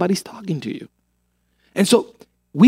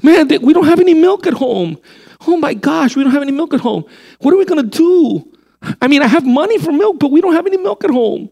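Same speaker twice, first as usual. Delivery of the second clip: higher-pitched mock fretting over milk; panicking shopper; 7 semitones higher than usual. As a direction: fret helplessly, hammed up. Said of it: "standing in the middle of a supermarket and saying".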